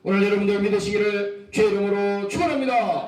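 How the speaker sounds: loud proclaimed benediction; loud blessing. Real, not acted. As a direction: bless the congregation at full voice. (회중에게 크게 선포하는 축원)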